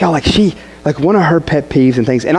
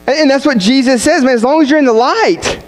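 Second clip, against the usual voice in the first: raised, high-pitched sermon delivery; impassioned preaching; 9 semitones higher than usual. Real, not acted. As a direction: preach it high and urgent to the church. Preaches the point with raised force.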